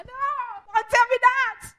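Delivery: high, drawn-out shout of protest; outraged yell, stretching the words; angry refusal. Acted. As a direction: explode in loud, high-pitched indignation. Acted angry refusal of advice.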